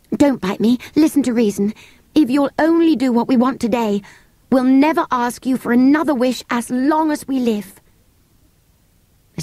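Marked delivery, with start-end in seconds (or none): 0.1-7.8 put-on high, urgent girl's voice; pleading girl. Girl persuading the creature to listen, high and urgent. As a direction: bargain earnestly, pitched up.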